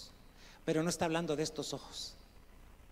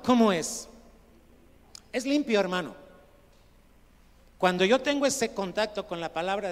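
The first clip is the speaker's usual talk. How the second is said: loud, projected pulpit voice; emphatic and loud; forceful scripture preaching; 4 semitones higher, 8 dB louder than usual. Real, not acted. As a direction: proclaim the verse to the whole room.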